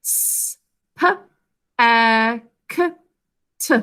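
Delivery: S, P, A, K, T each said as its own separate sound; isolated sounds for spelling. Shown as a demonstration. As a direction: sound out S, P, A, K, T one by one. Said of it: "what sounds do you hear".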